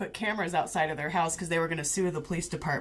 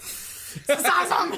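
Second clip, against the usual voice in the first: much higher, giggling delight; gleeful laughing; 11 semitones higher than usual. Retold or imitated. Watching the brawl unfold, high giggling glee. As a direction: laugh through it, loving the show. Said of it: "And I'm like".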